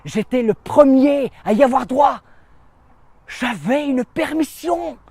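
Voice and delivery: high, rising excitement; elated disbelief. Acted. Soldier first in line for leave, marvelling.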